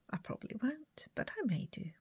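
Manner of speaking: unvoiced whisper to herself. Real, not acted. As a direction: whisper it under your breath. Whispering to herself while crafting.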